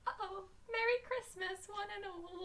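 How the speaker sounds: high, very soft little voice; faint, half-hearted festive greeting; mock forlorn cheer. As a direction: wish it meekly, as if crushed. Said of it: "And you're like".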